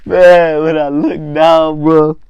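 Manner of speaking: loud, high cry to the listener; worked-up exclaiming. Real, not acted. Storyteller reliving the moment he looked down, high loud exclaiming. Cracked up, cries out the memory.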